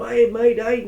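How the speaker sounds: cheerful Australian wildlife-host hello to a snake; light Australian Crocodile Hunter impression. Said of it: "I tried to do like the crocodile hunter".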